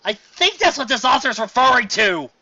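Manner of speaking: high, loud, slow outburst; exasperated realization. Real, not acted. Reviewer realizes the author's reference, high loud exclamation.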